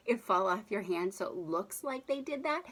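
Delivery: normal talking voice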